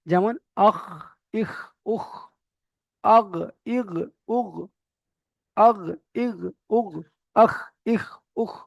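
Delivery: আখ ইখ উখ with a rasping throat kh, আগ ইগ উগ with a voiced gh; guttural kh gh demo. Shown as a demonstration. Teacher drilling students in throat letters. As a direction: rasp each আখ ইখ উখ at the top of the throat. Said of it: "কণ্ঠনালীর একদম শেষ হতে উচ্চারিত হয়".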